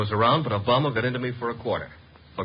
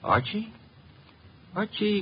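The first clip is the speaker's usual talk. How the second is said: high, pitched-up mock surprise; 11 semitones higher than usual. Archie playing innocent with Laura, mock surprise. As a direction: act startled at hearing the name.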